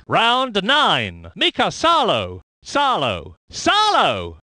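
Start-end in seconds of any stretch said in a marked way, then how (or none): 3.5-4.4 driver's surname called out with a lift; exclaimed name. Acted. Commentator naming the driver Salo, exclaimed call. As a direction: call the name out with a falling finish.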